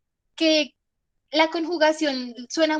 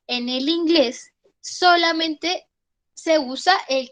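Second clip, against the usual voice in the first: slow, drawn-out, higher emphasis; emphatic slow phrase; 5 semitones higher, 4 dB louder, slower than usual. Shown as a demonstration. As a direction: stretch "solamente" to drive the rule home. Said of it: "stressing key rule".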